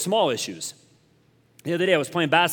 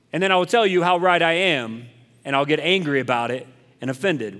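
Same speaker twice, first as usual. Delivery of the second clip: ordinary talk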